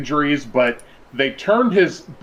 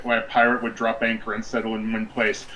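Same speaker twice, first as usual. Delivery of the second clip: normal talking voice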